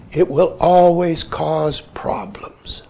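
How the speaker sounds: put-on soft, slow, low voice of an old teacher; quoting the teacher. Teacher quoted to student.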